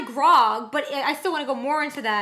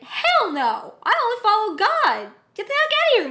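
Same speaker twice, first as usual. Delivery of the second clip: louder, pitch climbing high; indignant mock refusal; 8 semitones higher, 5 dB louder than usual. Viewer voicing Jesus refusing the devil, mock indignation. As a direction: act out an outraged rebuff, rising.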